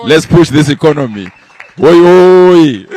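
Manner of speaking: loud, high rallying cry; shouted Oi oi chant to close the speech. Real, not acted. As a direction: whip the crowd up with a cheer.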